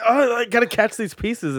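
high-pitched retold alarm; mock panic. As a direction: act out a frantic scramble, voice jumping up. Retelling his acid-trip panic over phone.

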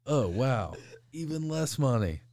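dad to son, a loud high sarcastic jab; mock sarcastic dad. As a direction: play the unimpressed father, loud and high. Dad's sarcastic reply, retold.

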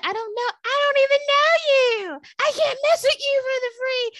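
a high, loud retold outburst; exasperated yell. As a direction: shout him down in disbelief. Retelling throwing him out.

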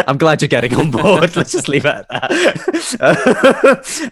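dissolving into laughs while answering; laughing. Real, not acted. Humouring mum about Krishna, laughing loud.

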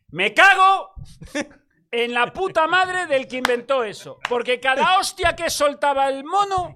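high, loud furious shout; yelling in comic rage at the 4D seat; furious outburst. Real, not acted. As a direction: blow up at the cinema, all out. Furious rant about the 4D cinema.

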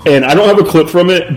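usual speaking voice

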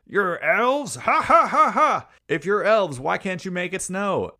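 loud, high, laughing southern mayor scoffing at elves; mocking southern mayor. Retelling the movie, the southern mayor scoffs at the elves, loud laughing jeer. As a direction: ham up a jeering small-town mayor with a big laugh. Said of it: "the mayor's this, like, southern mayor".

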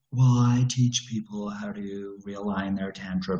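put-on droning guru voice; mock-serene monotone explanation; pretentious tantra teacher. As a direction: mimic a self-important spiritual coach. Acting a renamed tantra teacher.

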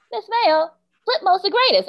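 put-on high-pitched eager kid; student's voice. Student answers teacher about [name], high kid voice. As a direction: pipe up high like a young student. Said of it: "this one little girl said".

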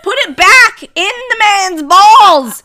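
loud exclaim. Acted. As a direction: cry it out in mock horror. Joking outburst about childbirth.

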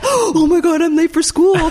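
high, loud, frantic gasp; panicked schoolkid. Retold or imitated. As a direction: squeal it like a kid running late. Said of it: "kind of thing".

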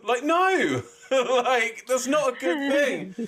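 high, laughing protest; laughing disbelief. Real, not acted. Mocking the crowd's cheer, high and laughing.

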